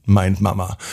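ordinary delivery, nothing marked

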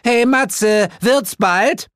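loud, high holler; impatient call. A narrator's reading. Woman hollering up the stairs at Matze, impatient. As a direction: shout up for him to hurry.

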